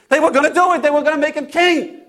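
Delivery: high, quick outburst with a laugh; laugh, then burst out high; exasperated excitement. Acted. Disciple reliving the crowd crowning Jesus, excited.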